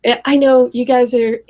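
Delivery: normal talking voice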